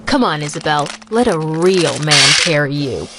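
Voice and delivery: put-on low, cocky rival boy; Jake. Rival offering to carry Isabel, low cocky voice. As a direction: pitch it down and take charge. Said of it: "Jake shoved him aside".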